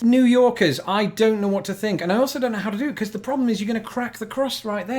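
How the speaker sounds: high, loud, exasperated arguing; worked-up disbelief. Real, not acted. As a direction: keep pushing back, half laughing. Arguing over folding pizza.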